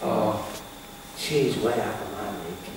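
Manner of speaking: low, quiet self-doubting mutter; a hint of the negative voice in your head; inner critic voice. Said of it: "them two little voices".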